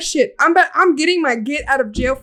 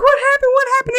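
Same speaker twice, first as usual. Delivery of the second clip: high, urgent imitation of a friend; frantic questioning; 12 semitones higher, faster than usual. Friend fleeing in the car, frantic high questioning. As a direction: pitch jumps up in mock alarm. Friend demands answer during getaway.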